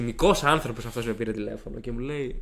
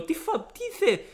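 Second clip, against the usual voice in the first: high-pitched, broken-off question; mock bewilderment; slower than usual. Student baffled by headmaster's order, squeaky mock confusion. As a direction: blurt it in comic high-voiced disbelief.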